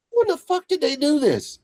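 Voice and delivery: very high, incredulous exclamation; amazed disbelief. Retold or imitated. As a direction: viewer baffled by the camera work. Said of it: "and you're like".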